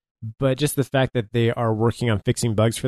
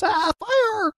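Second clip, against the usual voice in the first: very high, strained cry of alarm; squeezed, high-pitched mock scream; mock yell. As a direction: imitate a game character yelling, pitched way up. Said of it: "while Capital yells".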